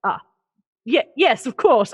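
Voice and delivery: a hint of a sheepish guard; mildly embarrassed agreement; flustered guard. Guard sheepishly agreeing with the other guard. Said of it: "then is like".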